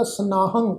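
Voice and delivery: ਸਨਾਹੰ ending on a nasal "ng". Shown as a demonstration. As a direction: let the final "hang" of ਸਨਾਹੰ hum through the nose. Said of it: "ਨਾਸਕੀ ਉਚਾਰਨ ਹੋਏਗਾ". (ਸਨਾਹੰ ਦੇ ਅੰਤ ਵਿੱਚ ਨਾਸਕੀ ਉਚਾਰਨ)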